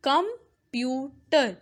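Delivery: कंप्यूटर broken into three beats, com, pyu, ter; कंप्यूटर with a gap after each syllable. Shown as a demonstration. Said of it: "syllable का मतलब होता है sound".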